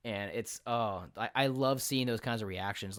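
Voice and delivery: usual speaking voice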